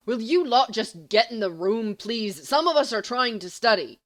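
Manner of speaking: loud exasperated yell across the room. Acted. Sirius yelling at James and Lily to come in, loud and fed up. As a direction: shout them into silence, fed up. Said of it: "Sirius throws his quill down and yells".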